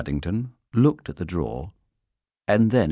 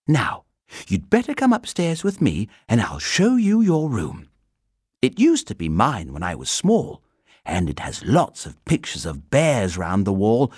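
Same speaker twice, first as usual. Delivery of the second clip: put-on lively, higher child's voice; friendly girl; 6 semitones higher, 4 dB louder than usual. A narrator's reading. Girl offering the bear her old room, friendly. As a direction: chatter as a welcoming girl.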